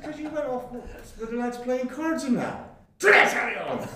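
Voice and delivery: high, giggly back-and-forth; laughing retort. Retold or imitated. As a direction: bicker through laughter.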